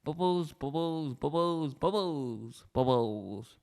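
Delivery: slow sing-song repetition, falling at the end; drawn-out comic chant; repeated for laughs. Shown as a demonstration. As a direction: repeat it slowly, stepping down in pitch. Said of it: "say the word bubbles several times".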